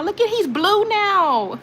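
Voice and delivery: high, stretched-out delighted cry; excited exclamation. Real, not acted. Streamer marvels at mod's new blue name, excited cry. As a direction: marvel at it, drawing it out.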